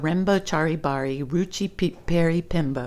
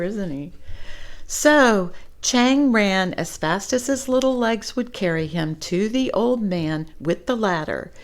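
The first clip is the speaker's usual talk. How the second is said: usual speaking voice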